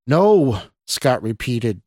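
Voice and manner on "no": raised, higher angry refusal; louder and angrier. A narrator's reading. Scott scrambles away from the woman, louder and angrier. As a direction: refuse with rising anger. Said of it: "this time louder and angrier".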